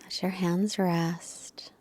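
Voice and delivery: hushed relaxation cues, trailing into silence; guided meditation. Real, not acted. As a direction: gently settle them into stillness, leaving space between phrases.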